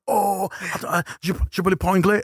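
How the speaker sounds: high, quick put-on French line; playful fake French-speaker voice, fast; mock French speaker. Speaker fakes speaking only French.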